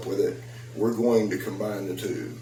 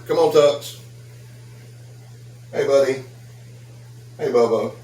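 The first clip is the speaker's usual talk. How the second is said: calling the dog; 3 semitones higher than usual. Real, not acted. Owner calling his dog Tucker over, raised call.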